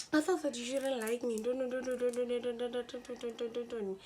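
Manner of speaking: lightly mocking babble; mock explaining. Classmate explaining herself to the storyteller, lightly mocking babble. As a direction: imitate her rambling excuse, slightly high. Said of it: "she started explaining shit".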